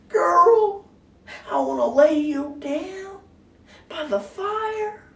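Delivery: very high, slow, drawn-out crooner's come-on; mock seduction. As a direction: croon it slowly, way up high, hamming up the romance.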